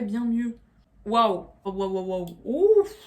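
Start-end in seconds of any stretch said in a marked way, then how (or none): 1.1-2.3 raspy, cracked morning voice; rough broken voice after waking; hoarse voice. Real, not acted. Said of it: "je suis devenue garou". 2.4-2.9 surprised exclamation. Real, not acted. Reacting to seeing herself.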